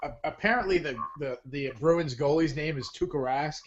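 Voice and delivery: usual speaking voice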